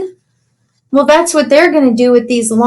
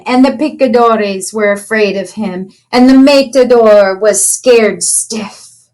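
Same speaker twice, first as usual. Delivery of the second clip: ordinary delivery, nothing marked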